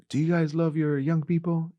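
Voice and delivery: high-pitched rallying question; preacher quizzing congregation. Retold or imitated. Preacher asking churches about youth, rallying. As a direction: call it out to the pews, pitched well up. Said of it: "when I go preaching at churches".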